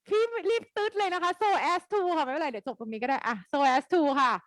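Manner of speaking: normal talking voice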